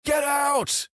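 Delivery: high-pitched startled yell. A narrator's reading. Trucker to someone climbing into the cab at night, yelling. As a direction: yell it high to scare off an intruder. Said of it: "and I yelled".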